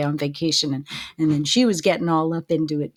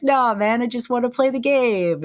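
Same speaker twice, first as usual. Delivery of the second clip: higher, louder pleading protest; mock exasperated player; 6 semitones higher, 4 dB louder than usual. Player arguing back at a stubborn game, mock exasperated. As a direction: plead with the game, playfully fed up.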